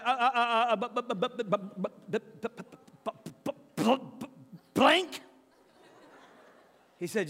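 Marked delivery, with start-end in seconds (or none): 0.0-5.2 high, loud stutter climbing to a squeaked "blank?"; retelling his own speechless reaction, hammed-up stutter; stammering shock. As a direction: sputter helplessly, then squeak the last word.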